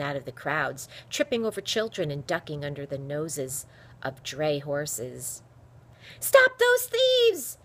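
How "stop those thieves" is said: high, loud, drawn-out scream; urgent shriek of alarm. A narrator's reading. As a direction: scream for the whole street to hear. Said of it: "she screamed".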